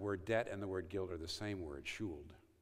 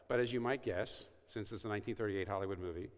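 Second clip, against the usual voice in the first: usual speaking voice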